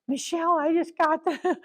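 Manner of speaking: high, startled call to a colleague; mock alarmed. Camp director calling Michelle, alarmed. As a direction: gasp it out like bad news just landed. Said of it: "So I'm like".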